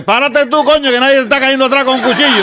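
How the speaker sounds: high, loud shouted comeback; acted indignant shout. Fleeing man turns on the knifeman chasing him, indignant shout. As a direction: yell back at the pursuer.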